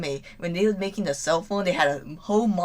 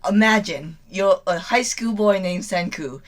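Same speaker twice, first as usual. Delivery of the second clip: usual speaking voice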